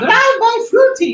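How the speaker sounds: high, loud campy shout; campy quote. Retold or imitated. Echoing the movie quote, high and camp. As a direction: toss it off with camp flair. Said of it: "do the accent".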